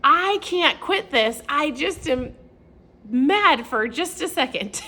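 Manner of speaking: high, loud and slow frustration; retold mad protest, high and loud; frustrated outburst. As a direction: let the frustration spill out slowly. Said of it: "I'm like".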